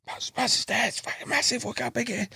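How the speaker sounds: high, hushed squeal of excitement. Retold or imitated. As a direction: imitate an excited friend, high and under the breath. Retelling friends spotting the big carp.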